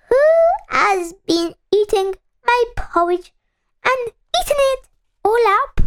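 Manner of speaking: tiny, high little voice; Baby Bear. A narrator's reading. Baby Bear finds his porridge eaten, tiny little voice. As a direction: squeak it small and high like a bear cub. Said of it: "in a tiny little voice".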